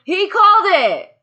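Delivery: squealing high and loud, almost crying; excited squeal. Real, not acted. Telling a co-host the slap was his call, high excited squeal. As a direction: blurt it out in giddy disbelief.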